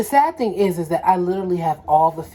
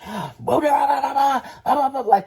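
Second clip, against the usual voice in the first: mimicking angry boyfriend; 8 semitones higher than usual. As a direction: gabble like a man going off. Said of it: "talking like this".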